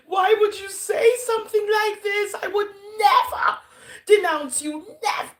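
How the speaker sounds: very high, loud fake crying protest; mock wailing. Disciple protesting to Jesus, hammed-up wailing sob. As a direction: wail it like a melodramatic sob.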